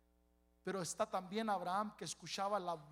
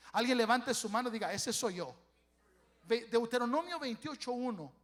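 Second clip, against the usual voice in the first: loud, quick call for raised hands; full-force preaching; 3 semitones higher, 8 dB louder than usual. Acted. Pastor calling the congregation to raise hands, loud and quick. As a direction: push the crowd to answer at once.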